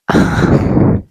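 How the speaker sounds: breathy speechless sigh; whispered sigh. Real, not acted. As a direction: let out a stunned whispered sigh. Speechless after a shocking book.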